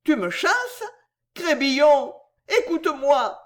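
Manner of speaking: cri aigu et pleurnichard; en larmes. A narrator's reading. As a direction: supplie en pleurant. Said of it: "s'écria Poisson en versant des larmes". (s'écrie en versant des larmes)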